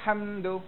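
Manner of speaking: "Hamdu" with the "ham" held too long; overlong syllable. Shown as a demonstration. Teacher demonstrates a faulty reading, overlong "Ham" in "Hamdu". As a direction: drag out the hum of "Ham" before "du". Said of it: "Tak kena juga".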